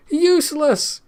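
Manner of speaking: high, loud cry of glee; the king shouts with amusement; laughing shout. A narrator's reading. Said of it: "he shouted".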